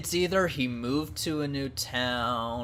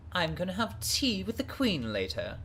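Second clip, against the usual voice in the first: put-on higher, posh British accent, very much up front; mock an upper-class British accent, chin up; proper British accent; 8 semitones higher than usual. Mocking an actress's British accent to a friend, posh British. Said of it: "she's putting on a proper British".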